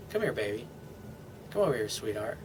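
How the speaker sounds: put-on a soothing boyfriend calling his girl to him; tender coaxing. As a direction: coax her close gently. Soothing girlfriend, arms around her.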